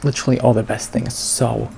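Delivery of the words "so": ordinary talk, nothing marked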